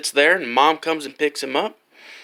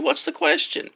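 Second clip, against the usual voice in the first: put-on old lady voice; 10 semitones higher than usual. Old lady coaxing the boy.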